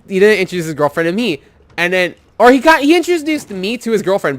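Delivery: normal talking voice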